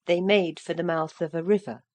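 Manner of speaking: usual speaking voice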